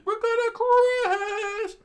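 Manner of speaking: very high, loud, slow falsetto; squeaky panic. Acted. Character about to hit the rock, in a drawn-out squeal. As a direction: wail it out in a tiny voice.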